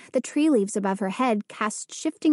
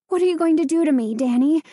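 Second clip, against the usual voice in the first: high, louder and rushed in panic; frightened plea; 4 semitones higher, 4 dB louder, faster than usual. A narrator's reading. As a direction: blurt it out fast, terrified. Frightened girl pleads with ghost.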